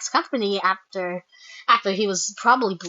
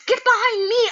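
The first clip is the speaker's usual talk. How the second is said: high-pitched mock alarm; hammed-up urgent warning; urgent command; 12 semitones higher, 4 dB louder than usual. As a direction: imitate a frantic protective cry. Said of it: "And she said".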